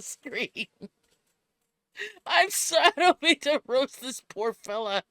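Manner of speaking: high, loud, cracking up; can't stop laughing; laughing hard. Real, not acted. Cracking up at host's looks.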